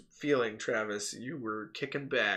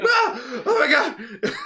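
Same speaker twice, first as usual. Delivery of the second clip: high loud panicked cry with a laugh; wife panicking at the siren, shrieking; freaking out; 12 semitones higher, 9 dB louder, much slower than usual. Retold or imitated. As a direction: shriek in alarm.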